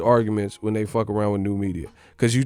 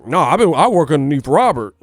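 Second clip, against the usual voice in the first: indignant denial; 4 dB louder than usual. Retold or imitated. Boyfriend insisting Robert is his manager, building indignant denial. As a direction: protest harder and harder.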